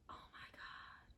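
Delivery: slow, stunned whisper; gasping in disbelief; shocked whisper. Retold or imitated. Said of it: "And it's like".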